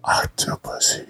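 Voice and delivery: Octopussy whispered, breathy and sly; teasing whisper. Pretend. Teasing his friend with a whispered pun. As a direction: slip the word in as a cheeky whisper. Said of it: "teasing whisper of a pun".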